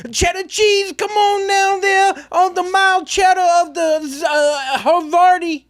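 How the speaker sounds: high, slow, drawn-out holler; mock stadium yell. Shown as a demonstration. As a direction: holler nonsense at the players in a put-on voice. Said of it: "You could yell".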